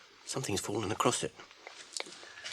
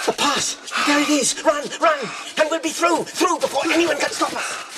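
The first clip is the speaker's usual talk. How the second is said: high, loud triumphant yelling; excited shout; 13 semitones higher, 8 dB louder than usual. Acted. Frodo yelling to Sam as they run for the pass. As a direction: shout it as you break free.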